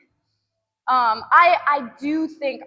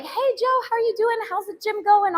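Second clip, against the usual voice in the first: much higher, cheery greeting; friendly lady; 9 semitones higher than usual. Retold or imitated. The lady at the pool greets her, in a high cheery voice. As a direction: chirp it like a friendly neighbour. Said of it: "the lady was like".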